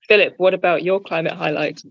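ordinary talk

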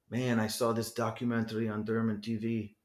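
light mock German accent on an older man's words; playful German-accent impression of the jazz musician. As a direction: put on a German accent, jokingly. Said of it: "I'm imitating a German accent".

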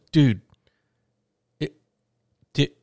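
imitating a stunned friend stammering. Bandmate at the window, too stunned to speak, stammering. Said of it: "he's stammering".

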